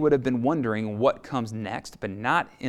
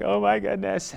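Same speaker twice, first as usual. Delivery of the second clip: a hint of an ugly cry, pitched up; mock ugly cry; 7 semitones higher, 3 dB louder than usual. Mary weeping at the empty tomb, mock sob. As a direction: imitate her sobbing exclamation lightly. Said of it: "like an ugly cry".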